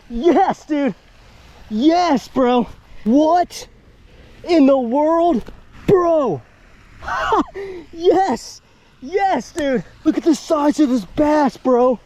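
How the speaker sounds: excited. Real, not acted. Celebrating a big bass catch.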